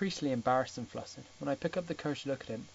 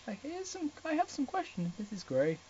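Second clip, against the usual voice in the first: put-on much higher, breathy girlish voice; flustered Ana; 12 semitones higher, 3 dB quieter than usual. Ana nervously asking Gray about Kate, high-pitched. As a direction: squeak out the question nervously.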